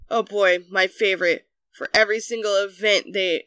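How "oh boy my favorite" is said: usual speaking voice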